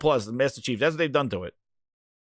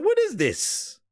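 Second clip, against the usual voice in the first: mock disbelief; 11 semitones higher, much slower than usual. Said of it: "I was like".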